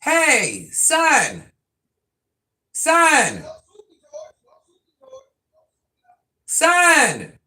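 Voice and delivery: loud high shout across the house; calling out loud. Real, not acted. Mother calling her son across the house, loud shout. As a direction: yell for the son in the back room.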